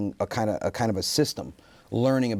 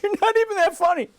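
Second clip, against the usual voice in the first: very high, loud mock wail; mock tearful wife; 5 dB louder than usual. Wife doubting her husband's comedian plan, high mock wail. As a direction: imitate a crying wife squeaking her insult. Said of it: "she broke down in tears".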